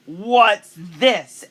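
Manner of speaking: put-on a spoiled girl catching them out; booming. A narrator's reading. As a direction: boom it from the doorway. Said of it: "Annabelle's voice boomed".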